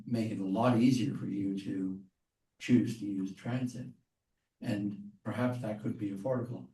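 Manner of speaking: normal talking voice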